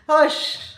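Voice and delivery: "Hush" said as "hoosh", short and loud; Jamaican hush. Shown as a demonstration. Teaching the patois "Hush", loud, high demo. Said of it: "when somebody say hush".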